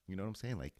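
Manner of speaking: ordinary delivery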